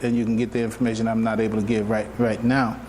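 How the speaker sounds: ordinary talk, nothing marked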